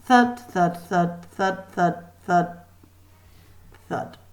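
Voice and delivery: ordinary delivery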